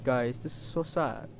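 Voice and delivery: ordinary talk, nothing marked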